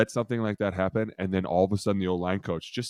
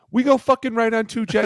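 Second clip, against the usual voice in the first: much higher, louder disbelief; mock exasperated coach; 13 semitones higher, 7 dB louder than usual. O-line coach pulling a player aside in exasperation. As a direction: spike it up in outrage.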